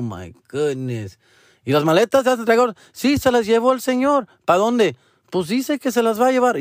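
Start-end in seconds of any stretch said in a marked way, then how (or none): 1.6-6.6 high, fast mock voices; mock phone back-and-forth. Son acts out the call about the bags, high and quick.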